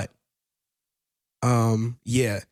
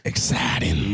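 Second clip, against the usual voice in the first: put-on low drawn country accent on Exciting; 8 semitones lower, slower than usual. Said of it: "exciting in a country accent".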